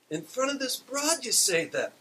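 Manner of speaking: high, loud indignant squawk. A narrator's reading. Parrot insulted in front of Beulah, indignant squawk. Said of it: "Wilma says indignantly".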